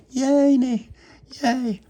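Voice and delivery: put-on very high, loud toddler squeal; piping baby-talk cheer. Baby Lucia cheers for the treat.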